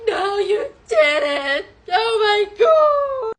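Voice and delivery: slow, high mock outrage; exasperated wail. Woman scolding him for the unlocked door, exasperated wail. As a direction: drag it out into a loud high wail.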